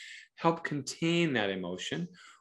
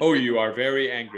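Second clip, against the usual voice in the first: mock robotic parent, mechanical and stiff; a hint of a flat robot reading a script; 3 dB louder than usual. Shown as a demonstration. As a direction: recite the feeling like a machine. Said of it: "You don't say this like a robot".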